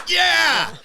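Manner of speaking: high, loud imitated cheer; crowd cheer. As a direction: shout it like a thrilled audience. Crowd cheering in retold story.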